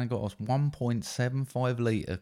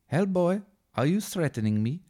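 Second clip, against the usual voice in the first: put-on much higher, indignant German accent; Johann Kraus, German accent; 10 semitones higher than usual. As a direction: demand it in an affronted German voice. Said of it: "Johann Kraus".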